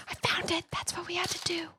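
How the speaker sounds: soft whisper. Real, not acted. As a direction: whisper close to the mic. Streamer whispers throughout.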